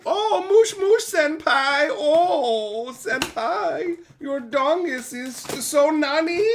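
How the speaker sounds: put-on high, slow squeaky anime girl. Reading a viewer's text-to-speech, high slow anime girl squeal. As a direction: coo it like a cutesy anime waifu. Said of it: "Text to Miku".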